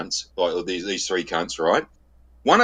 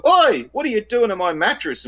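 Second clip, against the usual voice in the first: high, indignant call of a woman; a hint of an angry neighbour yelling; mock woman's shout; 12 semitones higher than usual. Woman at her door scolding movers, high indignant call. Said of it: "we hear this voice".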